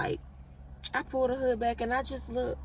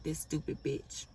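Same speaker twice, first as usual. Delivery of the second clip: muttered contempt; 6 semitones lower, 9 dB quieter than usual. Retold or imitated. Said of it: "they just looking at me like".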